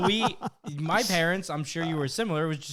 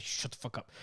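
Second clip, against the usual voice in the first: hissed whispered threat; threatening whisper; 4 semitones lower, 11 dB quieter than usual. Retold or imitated. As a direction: hush the little brother menacingly. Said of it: "cover his mouth and be like".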